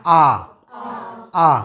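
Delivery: British R as a long open "aa", no r sound; British accent R. Shown as a demonstration. Teacher shows how Britons say R, British non-rhotic "aa". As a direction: say R the British way, dropping the r. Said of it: "British অ্যাকসেন্টে যদি বলি আমরা".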